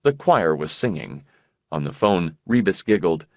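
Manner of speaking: ordinary delivery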